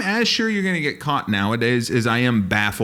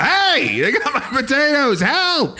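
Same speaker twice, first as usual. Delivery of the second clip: high, hammed-up cry for help; calling out; 11 semitones higher, slower than usual. Potato farmer calls for help after theft, calling out. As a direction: call out high through cupped hands. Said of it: "I cupped my hands and I called out".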